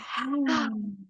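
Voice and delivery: soft noisy exhale sigh; audible sigh. Shown as a demonstration. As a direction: sigh it all out. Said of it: "an exhale as a sigh".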